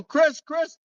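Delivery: retold call, sharp and high. Friend calling out to sleepy Chris to wake him, high call. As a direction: call his name to rouse him.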